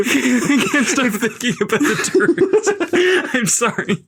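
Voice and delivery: slow words through real laughter; laughing. Real, not acted. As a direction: laugh helplessly while speaking. Cracking up at the joke.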